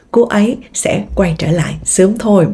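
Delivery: normal talking voice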